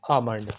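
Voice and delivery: आमंड with a silent l, aa-mand; correct pronunciation. Shown as a demonstration. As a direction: say आमंड without the l. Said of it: "Repeat after me".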